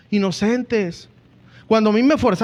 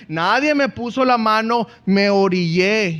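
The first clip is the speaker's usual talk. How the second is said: ordinary delivery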